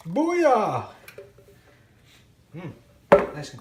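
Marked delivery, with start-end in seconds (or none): 0.1-0.9 excited shout. Real, not acted. Triumphant shout after finishing a step.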